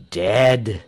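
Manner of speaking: low, falling, final "Dead"; solemn confirmation. A narrator's reading. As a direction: confirm it gravely as settled fact. Pastor confirms the death.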